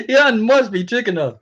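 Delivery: amused disbelief, chuckling it out; laughing. Real, not acted. Telling viewers about Elon Musk, laughing.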